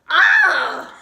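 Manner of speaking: high, loud frustrated "ah"; exasperated scream. Retold or imitated. Friend fed up with her ex's accusation, exasperated scream. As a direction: let out a short dramatized scream.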